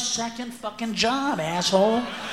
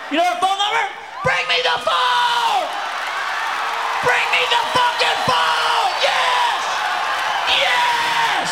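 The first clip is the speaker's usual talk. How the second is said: frantic shout; 11 semitones higher, slower than usual. Real, not acted. Demanding the phone.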